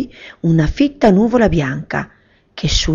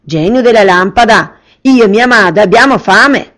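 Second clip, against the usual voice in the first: put-on boyish command; 5 semitones higher, 7 dB louder than usual. Boy commands the genie.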